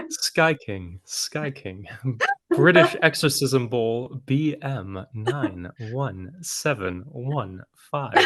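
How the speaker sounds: slow, flat coded broadcast voice; numbers station. Pretend. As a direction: read the call sign like a spy radio broadcast. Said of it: "turned on my number station voice".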